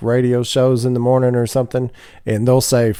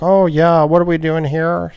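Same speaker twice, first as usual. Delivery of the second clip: higher mock Midwestern voice; playful Midwestern accent impression; 7 semitones higher than usual. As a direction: imitate a Michigan coworker's Midwestern accent. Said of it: "they're like".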